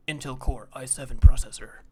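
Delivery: loud mock ad read with a weird S; loud tech-ad announcer imitation; Twitch ad announcer. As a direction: imitate the ad guy's SH-like S sounds. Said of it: "if you've seen the Twitch ads. He's like".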